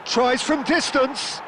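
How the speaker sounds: lively live play-by-play, rising with the action; fast sports commentary. Acted. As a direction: call the match as it unfolds.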